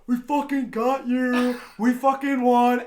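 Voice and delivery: drawn-out triumphant taunt; mock gloating. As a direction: imitate rivals crowing over their win. Quotes other car gloating.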